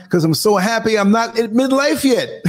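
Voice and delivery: high, gleeful, breaking into laughter; laughing punchline. Real, not acted. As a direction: let the joke rise into a real laugh. Jokes, bursts into laughing.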